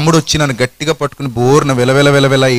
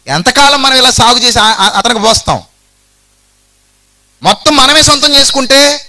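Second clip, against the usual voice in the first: higher, grasping servant's musing; greedy schemer; 7 semitones higher than usual. Acted. Preacher voicing Ziba plotting to grab the land, higher. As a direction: scheme aloud like a resentful servant. Ziba's greedy inner monologue voiced.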